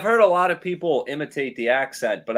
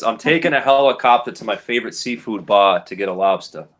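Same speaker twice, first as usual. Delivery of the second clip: lower-pitched native Rhode Island accent; 4 semitones lower than usual. Shown as a demonstration. Local champ reads the game sentence, Rhode Island accent. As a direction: read it in natural Rhode Island speech. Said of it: "say the sentence in his Rhode Island accent".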